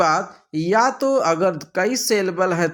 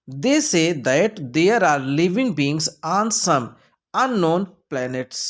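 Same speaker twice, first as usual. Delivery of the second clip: very slow, deliberate reading; slow reading aloud; much slower than usual. Shown as a demonstration. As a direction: read the sentence slowly. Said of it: "reads option slowly". (धीरे-धीरे वाक्य पढ़ना)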